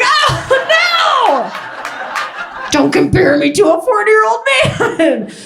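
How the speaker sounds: high, horrified squeal of protest; mock horror. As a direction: shriek in mock disgust at the comparison. Horrified reply to child.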